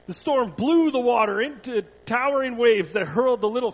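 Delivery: animated storytelling that rises and falls with the drama; story narration. A narrator's reading. Pastor reads a Bible story to children, animated storytelling. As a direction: read it to children, pausing for effect.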